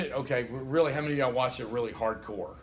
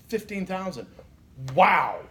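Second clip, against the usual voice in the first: wow louder at the end; mock shock; 4 semitones higher, 5 dB louder, slower than usual. Shown as a demonstration. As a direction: fake astonishment at the figure. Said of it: "I know what you're thinking".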